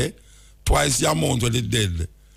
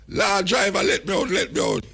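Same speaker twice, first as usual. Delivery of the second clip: imitated woman passenger; 9 semitones higher than usual. Woman passenger begging to be let out.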